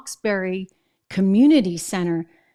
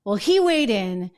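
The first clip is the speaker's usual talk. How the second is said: normal talking voice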